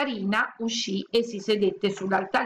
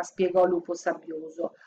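ordinary delivery

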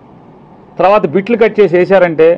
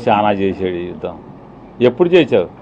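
sarcastic scoff; 11 semitones lower, 3 dB quieter than usual. Real, not acted. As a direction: sneer at the boast, dragging it out. Mocking a rival's war claim. (వెటకారంగా, నెమ్మదిగా, తక్కువ స్వరంతో)

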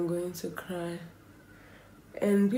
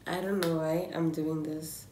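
normal talking voice

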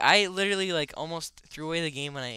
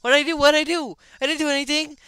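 high, loud, repeated denial; panicked protest; 10 semitones higher, 7 dB louder than usual. Acted. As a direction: protest your innocence to an accuser. Protests innocence after being accused.

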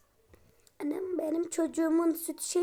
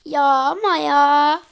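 loud groan; 12 dB louder, slower than usual. Real, not acted. Despair after the robbery.

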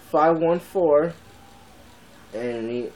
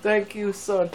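higher, hammed-up thankful parent; mock grateful dad; 7 semitones higher, much slower than usual. A vlogger mock-thanking his son, hammed-up and higher. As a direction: play the touched father, half joking.

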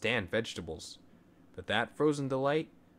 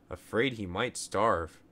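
usual speaking voice

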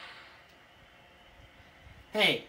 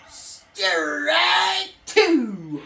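louder stretched umpire shout; umpire call; 4 semitones higher, 5 dB louder, much slower than usual. Pretend. Gamer mock-calling the final strikeout, umpire shout. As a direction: call it like the ump.

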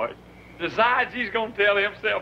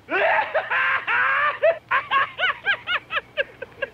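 long, high-pitched laughing fit; howling laughter. Real, not acted. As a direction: collapse into shrieking laughter. Laughs at finished punchline.

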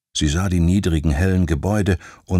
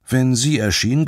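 plain reading voice

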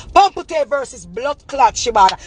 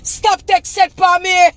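higher, near-crying plea; high wailing complaint; high distressed complaint; 7 semitones higher than usual. Real, not acted. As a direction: beg her to make it stop. Ranting at rival's mother.

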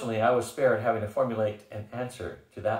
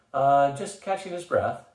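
smiling; 4 semitones higher, 5 dB louder, slower than usual. A narrator's reading. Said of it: "Bad Axe smiled".

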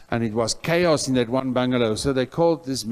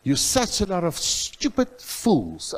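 retold rebuke; 5 semitones higher than usual. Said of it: "I'm saying".

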